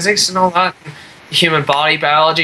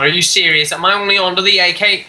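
usual speaking voice

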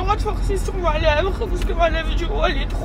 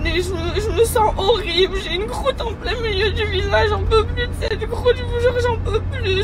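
tearful, pitch rising as she breaks; real tears returning, voice higher; crying again; 5 semitones higher than usual. Real, not acted. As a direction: pleure de nouveau, voix aiguë. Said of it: "il faut que j'arrête de pleurer".

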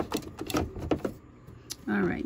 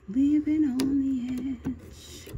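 slow, higher, savoring each word of "Living on the edge"; drawn-out wry repeat; 5 semitones higher, much slower than usual. Real, not acted. Quilter mocks her own risky stitching, slow wry drawl. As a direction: stretch "Living on the edge" out slowly and higher, like a rueful punchline.

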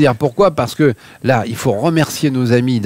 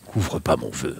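much lower and softer, booming; centurion voice; 12 semitones lower, 11 dB quieter, slower than usual. Shown as a demonstration. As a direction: drop deep like a centurion. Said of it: "avec ta voix de centurion".

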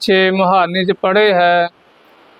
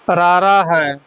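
normal talking voice

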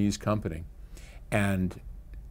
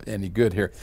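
normal talking voice

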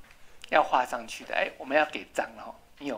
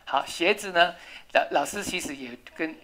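ordinary talk

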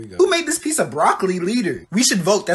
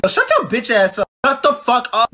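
loud, higher snap; angry shut-down; 7 semitones higher, 5 dB louder than usual. Acted. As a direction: cut him off harshly. Telling the planner to shut up.

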